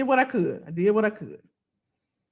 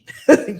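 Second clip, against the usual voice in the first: quick, louder burst of laughter; short laugh; 8 dB louder than usual. Real, not acted. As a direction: laugh it off briefly. Laughs at getting heated.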